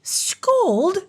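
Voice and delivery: high, offended Scold; angry outcry. Acted. Turritella insulted by the king, high indignant cry. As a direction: shriek the word back up high. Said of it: "cried Turritella angrily".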